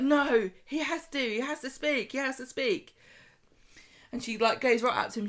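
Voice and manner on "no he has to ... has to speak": put-on higher, pushy girl voice; insistent Abby. Abby insists Sam has to speak. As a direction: demand it like a bossy kid. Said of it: "she's like".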